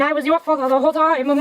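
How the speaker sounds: hammed-up big confession; mock dramatic reveal. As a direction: imitate a movie dad's revelation. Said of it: "and he's like".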